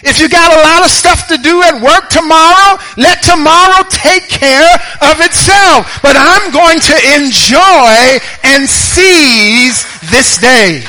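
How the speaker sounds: loud, high, impassioned sermon voice; fervent preaching. Acted. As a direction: preach it hard to the congregation. Preaching at full force, loud.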